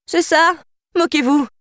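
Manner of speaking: high, mock-offended princess strutting; playful outcry. A narrator's reading. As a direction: cry it out high, hamming the pout. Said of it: "s'écria-t-elle en se pavanant comiquement".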